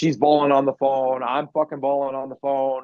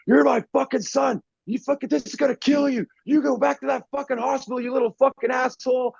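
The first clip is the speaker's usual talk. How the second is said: high-pitched, frantic imitation of an angry father; dad losing it; 11 semitones higher than usual. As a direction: rant like a dad losing his mind over the phone. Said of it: "My dad's losing his mind on the phone".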